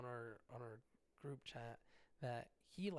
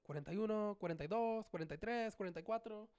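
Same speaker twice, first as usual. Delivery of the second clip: mimicked counter calling numbers; 8 semitones higher, faster than usual. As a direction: call out the numbers like the deli worker. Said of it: "he started going".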